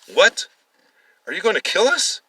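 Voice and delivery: high, loud terrified cry; panicked shriek. Acted. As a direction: shriek out in disbelief and fear. Captive panics.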